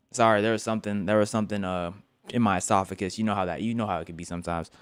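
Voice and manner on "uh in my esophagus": ordinary delivery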